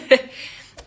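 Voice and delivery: real light giggle; amused chuckle. Real, not acted. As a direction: chuckle after saying thanks. Laughs after accepting the compliment.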